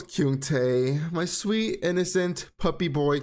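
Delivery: ordinary talk, nothing marked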